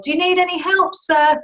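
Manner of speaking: high mock yell from a boat; captain yelling. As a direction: call out to a man in the water, pitched high. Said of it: "the captain of the boat yelled".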